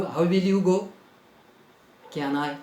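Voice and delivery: ordinary talk, nothing marked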